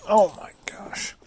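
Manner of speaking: breathy whispered oh my gosh; whispered exclamation. Real, not acted. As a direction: exhale it as a whisper. Exhausted after stubborn nut.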